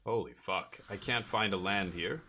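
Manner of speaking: normal talking voice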